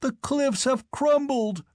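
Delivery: high, slow cry of dread; aghast at the disaster; horrified cry. Acted. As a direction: livid squire crying out. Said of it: "cried the livid squire".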